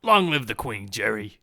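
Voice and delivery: loud mock British sitcom neighbor, slipping into Australian; British-accented Kramer. Co-host performs the requested royal cheer, botched British Kramer. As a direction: attempt British, land nearer Australian. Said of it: "Sounded more Australian".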